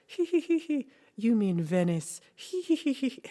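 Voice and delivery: high, loud silly hee-hee giggling; mocking giggle. The Italians laughing at the mix-up, high loud giggles. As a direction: titter loud and high like smug locals.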